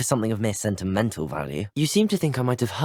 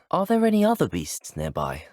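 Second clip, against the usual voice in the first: ordinary talk, nothing marked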